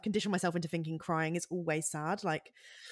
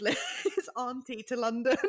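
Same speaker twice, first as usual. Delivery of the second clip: higher, breaking into laughter mid-word; real laughter; 8 semitones higher, 5 dB louder than usual. Real, not acted. Admitting she cried at Paddington Two, laughing as she talks. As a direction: laugh through the end of the sentence. Laughing at own film tears.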